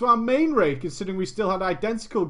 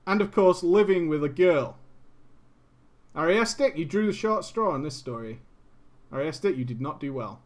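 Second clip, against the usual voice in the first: ordinary delivery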